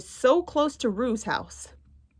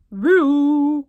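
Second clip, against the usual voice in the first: higher, louder call across the house; 5 semitones higher, 7 dB louder than usual. Acted. Tigger calling for Roo, calling out loud. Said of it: "called Tigger".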